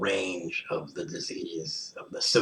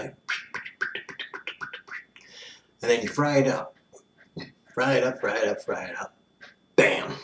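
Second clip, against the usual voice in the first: lightly hammed-up sponsor pitch; playful infomercial voice; mock ad read; 2 semitones higher than usual. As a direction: sell the product like a cheesy ad.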